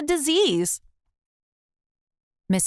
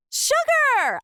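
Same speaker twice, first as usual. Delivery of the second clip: high ringing order; commanding cry. A narrator's reading. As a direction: command it like a general. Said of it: "I commanded".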